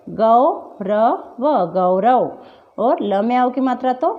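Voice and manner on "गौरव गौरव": गौरव drawn out slowly with /əo/; slow correct au. Shown as a demonstration. Teacher slowly modelling गौरव for the class. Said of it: "क्या पढ़ेंगे".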